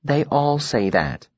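slow, soft and gentle. Acted. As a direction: soften it like a kind older friend. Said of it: "her voice gentle".

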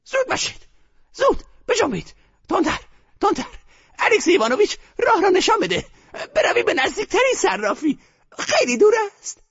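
put-on high, hurried old woman; bossy orders. A narrator's reading. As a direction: keep barking to hurry everyone.

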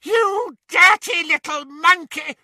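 high, loud furious bawl. A narrator's reading. Bellatrix insulting Dobby, bawling in rage. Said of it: "bawled Bellatrix".

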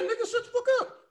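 squeaky high mocking voice; a high silly mock comeback; put-on voice. Bro mocking the shocked audience, squeaky high.

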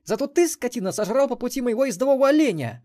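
high, loud angry reproach; angry girl. A narrator's reading. Melissa angrily blames Xen for eating her deer, loud. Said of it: "зло прошипела Мелисса".

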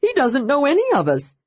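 high, eager, bubbling voice; bursting to tell, pitched high; barely contained excitement. A narrator's reading. Tasselhoff to Tanis about the knight, barely containing excitement. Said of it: "barely able to contain his excitement".

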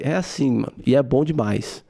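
ordinary talk

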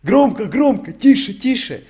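high, loud whiny parroting; mocking chat. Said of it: "когда вы пишете".

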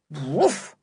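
a single bright woof; joyful dog bark. Acted. Timmy chasing a rat, joyful bark. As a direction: bark like a happy dog mid-chase. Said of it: "said Timmy, joyfully chasing his rat".

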